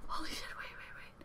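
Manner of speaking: whispered, fading almost to nothing; real stunned whisper trailing off; shocked whisper. Real, not acted. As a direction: breathe it out in disbelief. Fan realising show ended.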